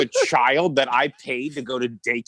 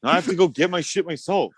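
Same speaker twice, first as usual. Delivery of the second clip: raised whiny complaint; complaining to mom; 6 semitones higher than usual. Retold or imitated. Son griping to mom about coffee, higher whine. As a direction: lift the pitch and whine. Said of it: "I was like".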